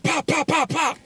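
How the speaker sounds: high sharp pop pop pops; sandal smack sounds. Retold or imitated. Retelling mom beating her son, high pops. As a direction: mimic a sandal slapping.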